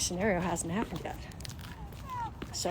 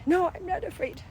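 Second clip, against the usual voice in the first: put-on much higher small child voice; mock little child; 9 semitones higher than usual. Little kid answering the parent, high and small. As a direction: play a small kid protesting.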